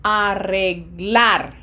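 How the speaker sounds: Arreglar drawn out slowly, last syllable stressed; slow teacher demo of Arreglar with long vowels; long vowels, final stress. Shown as a demonstration. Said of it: "long vowels and emphasis on syllable number one".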